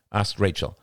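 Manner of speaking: ordinary delivery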